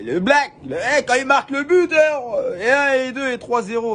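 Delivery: mock street talk. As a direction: ham up the boss's clumsy street talk, in scorn. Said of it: "t'as parlé comme si t'étais au quartier".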